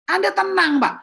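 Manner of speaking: calming the customer. Shown as a demonstration. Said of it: "Maka dikatakan di flyer kita".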